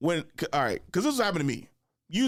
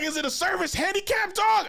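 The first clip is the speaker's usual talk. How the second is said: very high, half-laughing imitation; mock question. Imitating the dude asking about the dog, very high mock voice. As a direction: squeak the question out incredulously.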